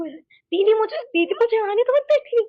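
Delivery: high, loud frantic plea to be let in; panicked pleading. Retold or imitated. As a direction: beg her big sister at the gate, panicking. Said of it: "उसने इसे प्यार से नहीं बोला था, वो बिल्कुल पागल हो गई थी". (घबराई हुई, ऊँची और तेज़ आवाज़ में गिड़गिड़ाना)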